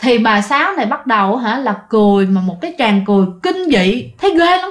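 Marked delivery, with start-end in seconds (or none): none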